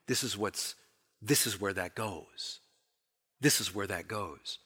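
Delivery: soft, low and steady, as if reading a road sign; quiet pointing voice. Acted.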